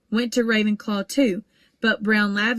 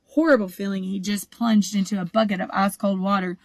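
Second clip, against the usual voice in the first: ordinary delivery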